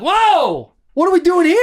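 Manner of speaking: high, alarmed reaction; mock alarm at the crowd; startled at rowdy fans in the stands, high and alarmed; startled whoa.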